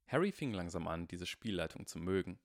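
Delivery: ordinary delivery, nothing marked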